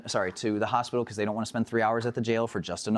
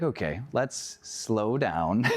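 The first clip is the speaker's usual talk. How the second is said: calming slow down; 2 semitones lower, much slower than usual. Retold or imitated. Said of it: "And I was like".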